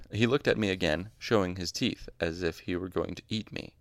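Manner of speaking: plain reading voice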